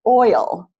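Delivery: OIL in two syllables, OY-ul, stress on OY; oil as OY-ul, first beat strong; two-syllable pronunciation. Shown as a demonstration. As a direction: stretch oil into OY-ul. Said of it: "It's actually pronounced oil".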